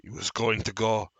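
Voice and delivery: loud declaiming with a halting search for words; strange deranged man. Acted. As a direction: proclaim it, falter, then resume loudly. Strange man reports dwarf's plan.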